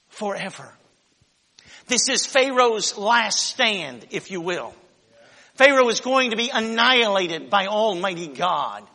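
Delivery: usual speaking voice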